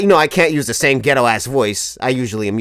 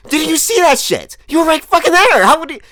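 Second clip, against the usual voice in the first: much higher and louder disbelief; indignant shout; 5 dB louder than usual. Retold or imitated. At the friend who claims he missed it, loud indignant. Insisting friends saw his dance.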